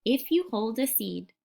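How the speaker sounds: put-on unhurried storytelling voice for a young child; picture book read-aloud. Teacher reading a picture book to a young child, gentle storytelling. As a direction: read it like a bedtime picture book, letting the pauses breathe.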